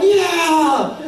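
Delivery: high, loud mock cheer with a laugh; cheering yeah. Parents cheering their team's lead, excited yell. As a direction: whoop like a fan in the stands.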